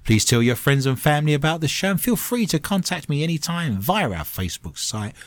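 show host voice. Acted. Show host sign-off read.

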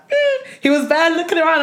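high, sheepish mm; mock awkward Otis. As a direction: play him squirming with embarrassment. Imitating Otis looking around.

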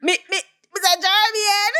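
high, slow, drawn-out impression; imitating voice-note man. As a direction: mimic a man calling out on a voice note. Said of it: "with this man say".

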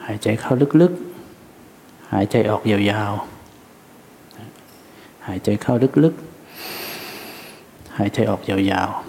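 very slow, soft breathing guidance; soft spa whisper. Acted. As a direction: draw each word out like a long breath. Leads breathing meditation.

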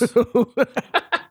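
high burst of giggles; laughing. Real, not acted. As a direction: keep giggling at the joke. Laughs on at the silly name.

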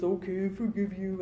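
soft, mumbled, grudging forgive; a kid's reluctant forgiveness, half-hearted and soft; grudging like a ghoul. Retold or imitated. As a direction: drag it out like a sulky ghoul. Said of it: "Not like a ghoul".

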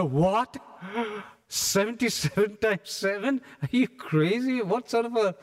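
outraged disbelief, thrown back; shocked disbelief. Retold or imitated. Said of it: "Everybody almost gasped".

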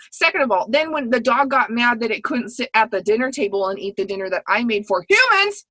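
ordinary talk

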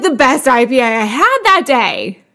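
ordinary talk

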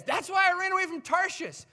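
loud, high-pitched indignant retort; acted outburst of self-justification; angry protest. Jonah explains his flight to God, angry protest. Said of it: "but he is so angry".

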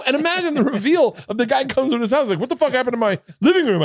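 excited. Retold or imitated. Acting out the reveal.

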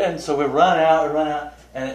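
normal talking voice

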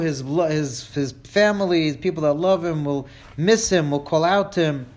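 usual speaking voice

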